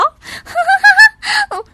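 high, loud squealing laugh; doubled-over laughter. A narrator's reading. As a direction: burst out laughing at the fake tiger. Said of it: "笑得直不起腰".

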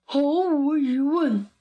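slow, drawn-out storybook bear; bear character. A narrator's reading. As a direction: agree ponderously as a bear. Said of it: "熊说".

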